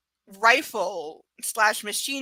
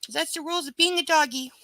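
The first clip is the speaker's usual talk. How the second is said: lightly raised, higher puppy voice; voicing the dog; 7 semitones higher than usual. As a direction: speak for the dog in a higher, childlike voice. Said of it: "He's like".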